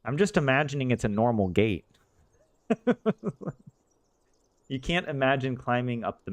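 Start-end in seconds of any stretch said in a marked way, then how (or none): 2.7-3.7 sudden high-pitched burst of laughter; amused giggle at the text. Real, not acted.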